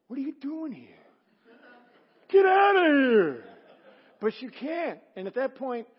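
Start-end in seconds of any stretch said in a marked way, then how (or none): 0.1-3.4 much higher, loud half-laughing yell; mock outraged shout. Preacher to wayward couple, imagined mock outraged shout. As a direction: yell it like you want them gone, laughing. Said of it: "I wanted to go up and go".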